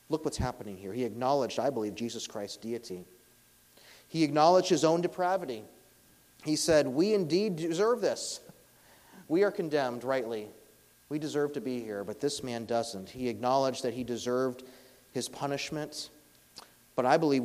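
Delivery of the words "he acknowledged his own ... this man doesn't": full-force preaching. Acted. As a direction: preach it out to the whole room.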